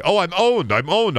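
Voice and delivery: high, mock-surprised read of the note; a put-on surprised victim reading the paper; mock reading aloud. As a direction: pretend to discover you've been owned. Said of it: "Oh, what's this paper say?".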